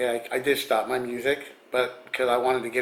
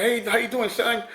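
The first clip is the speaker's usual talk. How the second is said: high, raised imitation of a hearty greeting; Mister T greeting; 9 semitones higher than usual. Star greeting a fan in retelling, high imitated voice. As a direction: call it out high, playing the star. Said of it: "And he walked like".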